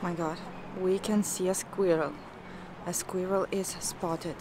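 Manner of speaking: ordinary talk, nothing marked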